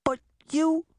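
very high, hesitant start; trembling. A narrator's reading. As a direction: falter in hurt disbelief.

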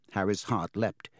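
plain reading voice

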